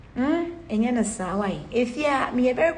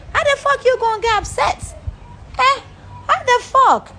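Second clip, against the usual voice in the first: exasperated rant; 10 semitones higher than usual. Real, not acted. Rising exasperated rant at critics.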